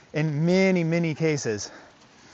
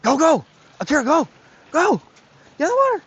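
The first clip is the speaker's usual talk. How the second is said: high, loud shouts at the dog; urging shout; 13 semitones higher, 5 dB louder than usual. Real, not acted. Trainer calls his dog Akira into the water, loud high shouts.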